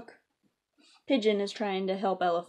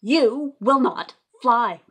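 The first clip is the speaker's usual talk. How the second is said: louder, slow and emphatic; firm refusal; 4 semitones higher, 13 dB louder, much slower than usual. Acted. Elephant insisting to Piggy, louder and slow. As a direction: put your foot down.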